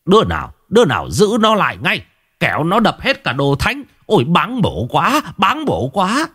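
alarmed elder crying sacrilege; urgent call. A narrator's reading. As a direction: rush them to restrain him, appalled. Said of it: "vội vàng gọi bọn thanh niên".

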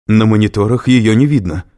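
loud, anxious report; rising alarm. A narrator's reading. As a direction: answer with mounting unease. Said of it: "с растущей тревогой ответил охранник".

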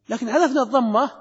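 ordinary talk, nothing marked

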